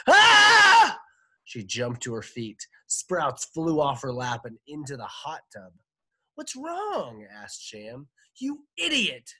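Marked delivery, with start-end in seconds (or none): 0.1-1.0 piercing high scream; very loud, high shriek of horror. A narrator's reading. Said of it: "Jane screamed". 6.3-7.2 high-pitched, puzzled question; husband taken aback by a scream; alarmed question. A narrator's reading. 8.4-9.3 high, loud furious insult; wife's high-pitched scolding shout; angry shout. A narrator's reading. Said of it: "shouted Jane".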